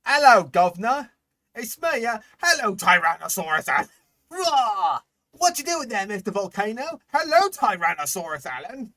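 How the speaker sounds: high, loud mock Cockney greeting. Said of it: "'Cause, like, hello?".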